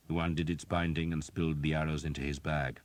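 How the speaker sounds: ordinary delivery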